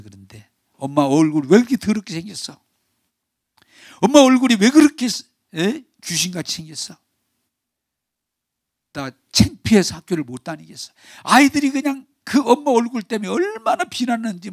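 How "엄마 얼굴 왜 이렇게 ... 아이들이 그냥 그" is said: put-on upset child's complaint. 딸이 엄마에게 울며 따짐.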